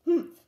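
loud scoffing humph; indignant humph. Acted. Vashti at her framed dot, scoffing humph. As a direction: huff it out, unimpressed.

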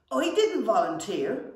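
a goblin brother protesting loudly. A narrator's reading. Goblin denies volunteering, protesting. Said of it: "said Saggy Pant".

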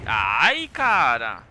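loud frustrated groan at a missed shot; exasperated loud cry of annoyance; frustrated outburst. Real, not acted. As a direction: blurt it out, annoyed.

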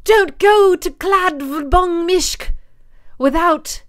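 high, loud, slow elf warning. Pretend. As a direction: boom it out like a fantasy elf. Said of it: "say it like I'm an elf".

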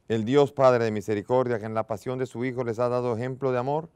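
solemn intoned collect at the altar; liturgical prayer. Acted. Priest imparts the blessing.

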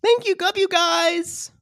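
very high, gushing thank-you; mock sweet reply. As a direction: coo it like a sugary fan message. S0 voices Michelle's Instagram reply.

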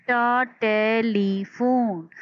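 ट split off before टेलीफोन, very slow; teacher sounding टेलीफोन. Shown as a demonstration. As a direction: spell ट then say टेलीफोन slowly. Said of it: "टेलीफोन तीन आवाज़ों में".

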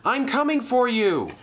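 high, urgent rescue call; shouting. Acted. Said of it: "he shouted".

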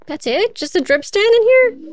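surprised. Real, not acted. Finds only a drip stand.

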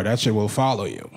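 ordinary talk

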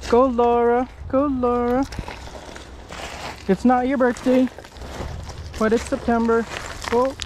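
cheering on. Real, not acted. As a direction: cheer her down the slope. Cheering on Laura.

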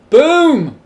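high, loud swooping boom; big drawn-out boom. Real, not acted. Gamer finishing the walls, big triumphant boom. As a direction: let the boom soar up and fall. Voices placing blocks, bigger second take.

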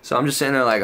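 normal talking voice

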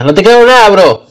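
loud incredulous shout; shouted disbelief. Real, not acted. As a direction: yell it, not buying it.

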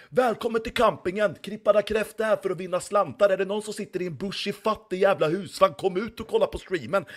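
high, loud announcer voice; campsite loudspeaker announcer. Pretend. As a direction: blare it to the whole campsite. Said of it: "lyssnar på dig i högtalaren på campingen".

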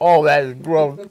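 loud, high, revolted; disgusted by taste. Real, not acted. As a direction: spit out the words in disgust. Reacting to salted egg taste.

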